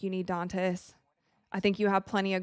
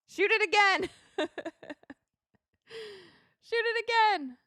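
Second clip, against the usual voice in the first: high-pitched urging shout; excited high call; 11 semitones higher than usual. Real, not acted. Streamer urging the team to keep shooting. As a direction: yell at the screen to shoot again. Mocking the team's missed shots.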